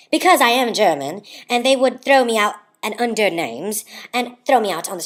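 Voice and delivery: theatrical, emphatic monologue voice; Katharine Hepburn. Acted. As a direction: declaim it with grand disdain. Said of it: "read it like Katharine Hepburn would".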